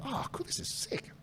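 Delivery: hushed, excited whisper; whispered delight. Retold or imitated. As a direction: whisper it like you can't believe your luck. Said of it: "So we're like".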